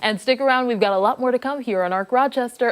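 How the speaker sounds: upbeat broadcast promo read, lifted and emphatic; news anchor break tease. Real, not acted. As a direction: throw to the break with punchy anchor energy.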